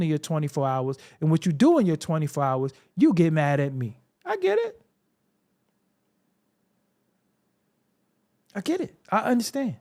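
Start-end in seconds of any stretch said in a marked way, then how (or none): 4.2-9.8 high, sing-song fake sympathy; a sarcastic high I get it; mock sympathy. Real, not acted. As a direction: pat them on the head with it. High mocking concession.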